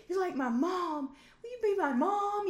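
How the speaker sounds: high, gushing heartfelt plea; gushing movie girl. Retold or imitated. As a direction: plead like a lonely girl on coke. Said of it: "and they're like".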